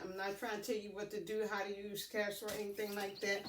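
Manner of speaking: usual speaking voice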